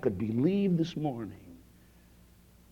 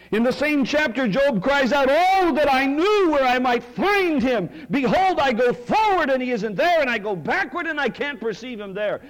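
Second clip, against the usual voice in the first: higher, louder cry of Job; impassioned preaching; 11 semitones higher, 5 dB louder than usual. Acted. Pastor voicing Job's cry, impassioned preaching.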